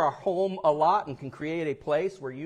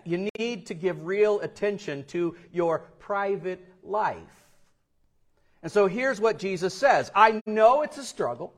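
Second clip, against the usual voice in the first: ordinary delivery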